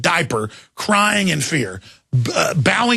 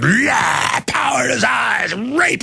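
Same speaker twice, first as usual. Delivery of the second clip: higher, shouted mock villain gloat; mock evil politician; 6 semitones higher, much slower than usual. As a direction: ham up a power-hungry villain's cry. Mocking Gingrich's inner thoughts.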